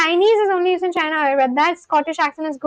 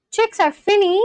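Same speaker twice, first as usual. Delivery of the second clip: higher, questioning "Chicks are finny?"; puzzled echo question; 4 semitones higher than usual. Real, not acted. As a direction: query "finny" as if you don't get it. Questions the comment just read out.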